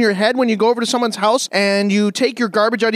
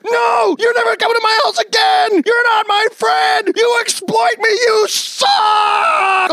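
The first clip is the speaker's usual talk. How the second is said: hysterical tantrum; 10 semitones higher, much slower than usual. Acted. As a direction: throw a screaming tantrum at him. Acted quarrel, hysterical neighbor.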